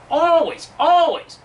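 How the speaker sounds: always, always shouted high and loud; emphatic repeat. Real, not acted. Ranting insistence on a point.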